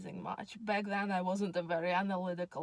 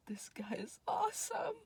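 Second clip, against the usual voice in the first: breathy whisper, pitched up; whispered thought; 9 semitones higher, 3 dB quieter than usual. Retold or imitated. A girl secretly thinking about the funny guy at the bar, whispered. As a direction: whisper it to yourself, delighted.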